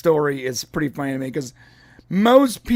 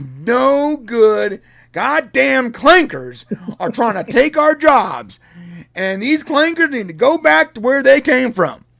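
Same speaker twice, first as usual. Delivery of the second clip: loud, higher mock-bigot rant; 7 semitones higher, 6 dB louder, slower than usual. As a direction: ham up an angry rant against robots. Mock anti-robot rant.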